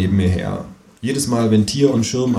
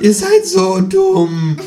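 very high, louder, drawn-out screech; croaking kangaroo; 5 dB louder, much slower than usual. A narrator's reading. Kangaroo insulting all the voters, screechy and slow. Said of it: "krächzt das Känguru".

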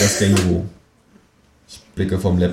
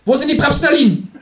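very high, loud kangaroo voice; shrieked kangaroo interrogation; demanding kangaroo; 5 dB louder than usual. Acted. Kangaroo threatens, demands an answer.

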